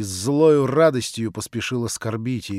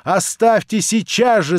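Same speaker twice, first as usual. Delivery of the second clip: furious shout, rising to a yell; 5 semitones higher, 6 dB louder, slower than usual. A narrator's reading. Natasha shouting at the prince to leave. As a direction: throw him out at the top of her voice. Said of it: "закричала она".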